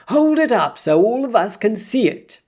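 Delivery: high crowd shout. A narrator's reading. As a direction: call out over a crowd. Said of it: "somebody shouted".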